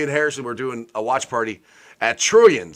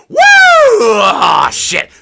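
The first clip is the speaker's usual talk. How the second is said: a high loud whoop dropping to a deflated curse; elated then crushed; 10 semitones higher, 12 dB louder, much slower than usual. Retold or imitated. His own Monday mood swing over the trip, whoop then deflated curse. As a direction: cheer big, then slump. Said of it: "my emotions Monday were like".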